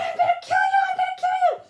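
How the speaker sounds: very high, loud imitated scream; re-enacted murderous screech; screaming threat. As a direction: mimic her shrieking in rage. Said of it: "she screamed".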